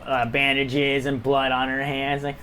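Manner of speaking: ordinary delivery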